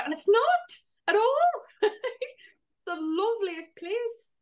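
high, delighted rise into laughter. Real, not acted. To her co-host about the app, high delighted gushing. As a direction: gush about how lovely it is.

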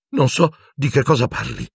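high, shrill frightened denial. A narrator's reading. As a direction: squeak it out in fear. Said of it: "con voce più stridula che mai". (Pettigrew nega con voce stridula e acuta)

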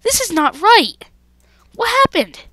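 high, loud disbelief; dismayed outcry. Real, not acted. Gamer upset that it's not right, high dismayed cry. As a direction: cry out high, falling away at each end. Dismayed at game going wrong.

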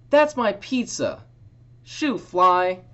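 put-on high, loud girl's yell. Girl yelling at the fly stealing her pizza, high and loud. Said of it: "A girl yelled".

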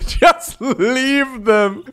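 very slow, relishing the words; drawn-out just leave them. Acted. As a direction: stretch it out slyly. Savoring viewer's plan.

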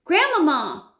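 high, loud call of Grandmama; boy shouting down to grandmother, high and loud. A narrator's reading. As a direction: call out anxiously from the tree. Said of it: "I shouted again".

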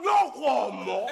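slow, laughing payoff; slow punchline. Acted. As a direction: drag out the catchphrase.

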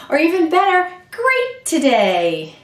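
a bright high lift; excited. Acted. Librarian cheering on the children. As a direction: brighten up with delight.

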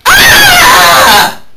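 piercing shrill-free high shriek; high scream. Acted. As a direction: scream in terror.

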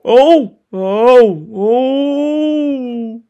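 high shaky ohs; high frightened oh oh oh; frightened whimpering. Acted. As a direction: whimper in fear.

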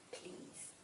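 whispered ending word. A narrator's reading. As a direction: breathe the last word.

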